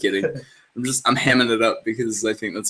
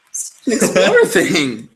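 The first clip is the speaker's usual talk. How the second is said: slower, higher, breaking into laughter; laughing hammed-up delivery; 5 semitones higher, 4 dB louder than usual. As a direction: draw out "explorer thing" through a laugh. Joking repeat of own line.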